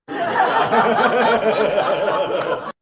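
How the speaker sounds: a high, wheezing burst of laughter; real helpless laugh. Real, not acted. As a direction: break up laughing at the joke. Laughing at his own story.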